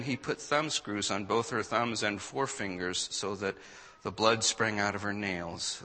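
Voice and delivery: ordinary delivery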